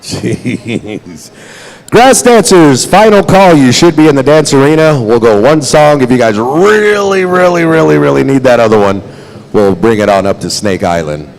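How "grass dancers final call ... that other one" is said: loud, higher emcee call; final call announcement. Acted. Emcee gives the grass dancers a final call, loud announcer voice.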